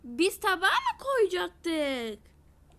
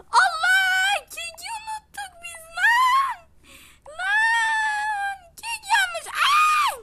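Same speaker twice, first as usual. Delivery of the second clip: high, loud panicked yelling with laughs; panicked shouting; 14 semitones higher, 10 dB louder than usual. Real, not acted. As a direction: shriek in real panic over the burnt cake. Burnt cake discovered, panicking. (yüksek sesle panik içinde bağırma)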